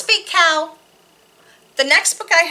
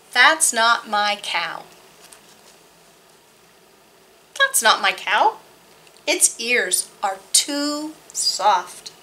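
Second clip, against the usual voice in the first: put-on reading to children; slower than usual. Reads board book to children.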